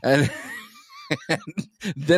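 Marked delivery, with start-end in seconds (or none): 1.3-1.4 high, breaking real laughter; burst of laughter. Real, not acted. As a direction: laugh helplessly through the word. Laughing at the prank call.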